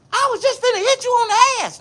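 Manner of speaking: mocking the man who swung the paddle in a high whine. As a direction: squeak out the man's weak excuse.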